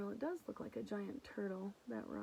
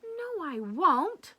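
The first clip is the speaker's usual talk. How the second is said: indignant Lulu; 9 semitones higher, 11 dB louder, much slower than usual. Acted. Lulu protests to brother.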